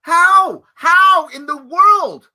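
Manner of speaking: mock disbelief. Mocking the opponent's question.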